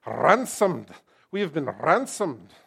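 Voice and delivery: emphatic Scottish accent with a rolled R; punchy mock Scottish delivery. Shown as a demonstration. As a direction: put on a Scottish preacher's accent. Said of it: "say that with a Scottish accent".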